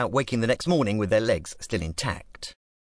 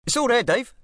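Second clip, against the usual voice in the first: much higher, louder East London drawl; 14 semitones higher, 5 dB louder than usual. A narrator's reading. Club boss hands money to gangster, East London drawl. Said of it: "with a thick East London drawl".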